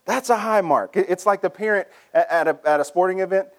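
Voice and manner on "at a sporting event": ordinary talk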